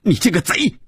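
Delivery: put-on high, shrill-pitched childish accusation shouted up; a boy screaming at his father; furious child's cry. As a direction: hurl it at him like a wronged child. Child yells at thieving father.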